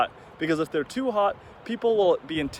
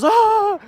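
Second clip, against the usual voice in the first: high, loud shriek of despair; a hammed-up cry of despair; 10 dB louder than usual. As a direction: shriek like her world just ended. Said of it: "in despair".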